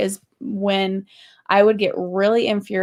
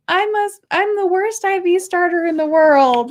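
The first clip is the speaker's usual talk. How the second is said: high, louder mock sob of self-pity; mock crying wail; 9 semitones higher, 5 dB louder than usual. Wife retelling coming home upset, high mock wail. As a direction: whine it like a wounded nurse. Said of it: "I came home crying, saying".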